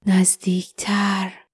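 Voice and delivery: slow, drawn-out whisper; whispered urging. Acted. An unseen presence urging someone nearer, slow whisper. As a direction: whisper it very slowly.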